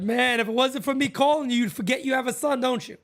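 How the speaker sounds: teasing mom on the phone in a high voice; mock guilt trip. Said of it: "I said".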